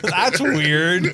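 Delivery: talking through laughter; amused, giggling disbelief; laughing. Real, not acted. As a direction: get it out while cracking up. Both cracking up over the accusation.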